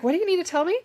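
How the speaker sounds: high-pitched mimicked question; playful imitation of a curious friend; imitated curious coworker. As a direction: pipe up high, playfully eager to know.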